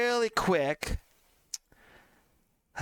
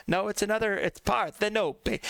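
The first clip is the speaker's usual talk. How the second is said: usual speaking voice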